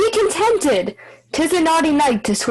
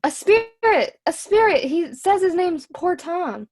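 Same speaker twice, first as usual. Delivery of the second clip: high, startled alarm; alarmed, high-pitched report; frightened fool; 6 semitones higher than usual. Acted. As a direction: blurt it out high, still spooked.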